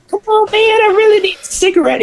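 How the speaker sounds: rushed, squeaky mock plea; high-pitched begging. Old man begging again for a cigarette, very high-pitched. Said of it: "has this real high-pitched voice".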